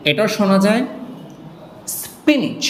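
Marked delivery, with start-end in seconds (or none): 2.0-2.6 স্পিনিচ pitched high, ending "ich", falling; alternative pronunciation. Shown as a demonstration. Teacher offers another form also heard, alternative pronunciation of স্পিনিচ. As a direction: say স্পিনিচ high with a crisp last consonant. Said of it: "এটা আরেকটা উচ্চারণ আছে".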